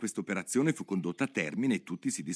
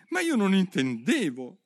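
pleading; 10 semitones higher, 4 dB louder than usual. Acted. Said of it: "supplicò la povera Alice".

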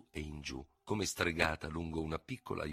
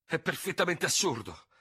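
higher, a touch relieved dismissal; pleased relief; 10 semitones higher than usual. Acted. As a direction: glad to have found a way out. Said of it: "disse, lieto di aver trovato una scappatoia". (sollievo compiaciuto, più acuto)

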